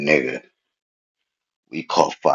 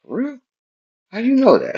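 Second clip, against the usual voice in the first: surprised question; faster than usual. Retold or imitated. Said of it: "He was like".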